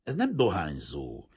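understated stern objection. A narrator's reading. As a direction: say it with firm disapproval. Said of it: "mondta szigorúan".

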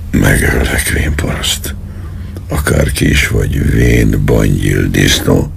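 menacing threat. Acted. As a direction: threaten him through a cruel grin. Colonel threatens with evil grin.

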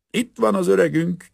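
high, loud boozy call from the door; drunken shout. Acted. Plumber bursting into the hut, shouting drunk. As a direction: bellow a cheery greeting. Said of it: "kiáltotta az egyik vízvezetékszerelő".

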